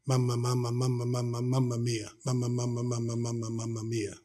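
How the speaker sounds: mumbled mama mia repeated, dying away into near silence; helpless stroke patient. Retold or imitated. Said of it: "all she could say was".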